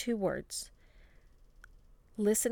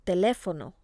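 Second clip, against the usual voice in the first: "Teléfono" with the weight on LÉ; stress on accented syllable; 4 dB louder, faster than usual. Shown as a demonstration. Teacher models written accents, stress on the marked syllable. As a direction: punch the second syllable of "Teléfono". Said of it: "to stress the correct syllable".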